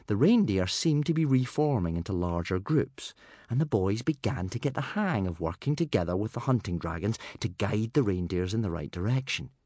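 usual speaking voice

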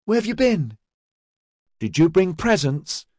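put-on high, eager little-girl questions; excited child. A narrator's reading. Daughters tugging at father, high and excited.

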